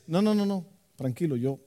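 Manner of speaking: usual speaking voice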